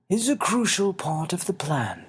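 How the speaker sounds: put-on a small old man asking kindly; little winged man. Xavier's voice.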